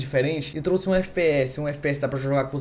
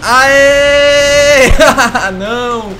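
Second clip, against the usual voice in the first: excited shout; 8 semitones higher, 12 dB louder, much slower than usual. Real, not acted. Celebrating a kill in game.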